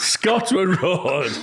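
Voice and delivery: slow Geordie, Scotland Road; Geordie correction. Shown as a demonstration. Correcting the others' attempt, slow Geordie accent. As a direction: slowly correct them in Geordie. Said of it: "then we'll repeat".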